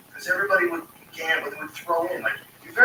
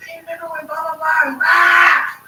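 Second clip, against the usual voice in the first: loud, rowdy barroom reminiscing, stretching it out; rowdy shout; 13 semitones higher, 12 dB louder than usual. Retold or imitated. Pool hall regular shouting old stories at friends, rowdy and loud. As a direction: belt it like a drunk regular across the room.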